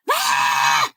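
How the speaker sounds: short sharp retold scream cry; a quick mock scream cutting through class; imitated scream. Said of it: "It's a loud scream".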